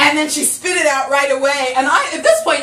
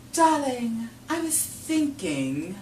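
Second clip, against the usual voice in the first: deadpan husband; 11 dB quieter than usual. Retold or imitated. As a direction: murmur it casually, slyly. Husband's understated line.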